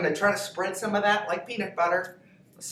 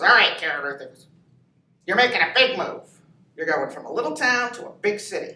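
gruff Marine father laying down the law, louder; stern dad voice; 7 dB louder than usual. Retold or imitated. Father sending daughter off, in a stern Marine voice. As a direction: boom it out like a drill sergeant dad.

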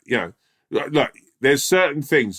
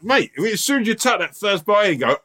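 normal talking voice

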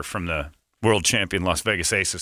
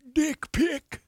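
high squeaky punchline; 3 dB quieter than usual. Pretend.

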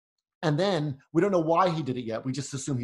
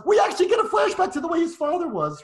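exasperated shout; 13 semitones higher, 5 dB louder than usual. Shown as a demonstration. Host shouts the repeat, mocking the film's obviousness. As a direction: repeat it much higher and louder, exasperated. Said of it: "as if you didn't understand".

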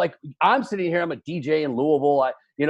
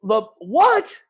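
high, loud, broken-off questioning; stunned disbelief; 12 semitones higher, 4 dB louder, slower than usual. Real, not acted. Co-host baffled by friend's photo, stunned. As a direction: stammer it out, ending on a high squawked question.

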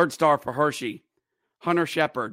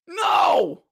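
much higher, shouting goal call; frantic play-by-play; 10 semitones higher than usual. Real, not acted. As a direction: scream the overtime goal in disbelief.

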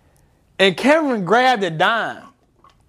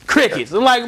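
ordinary talk, nothing marked

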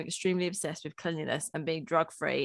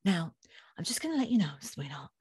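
softer, lower, lightly voiced prim cleaner; a hint of Kim telling the owner the bad news; impression of Kim; 3 semitones lower, 6 dB quieter than usual. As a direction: break it gently like a posh TV cleaner. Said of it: "And it'd be Kim".

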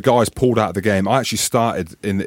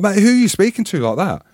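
retold heated protest; indignant retort; 7 semitones higher than usual. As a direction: push back hard at the coach. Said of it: "I'm like".

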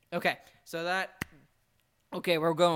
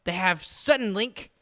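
loud, higher mock-impressed listing; sarcastic; 3 semitones higher, 9 dB louder than usual. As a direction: announce each name like a grand prize. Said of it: "I'm being sarcastic".

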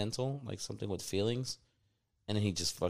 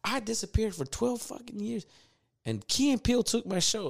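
much higher mock Chappelle rant; Dave Chappelle impression; 13 semitones higher than usual. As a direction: squeak out his complaint, indignant. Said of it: "he was saying it like that".

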